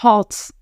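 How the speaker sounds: "Hearts" with an audible breathed H; deliberate aspirated H on "Hearts"; sounded H. Shown as a demonstration. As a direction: show how to puff out the H in "Hearts". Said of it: "C'est comme ça que ça se fait".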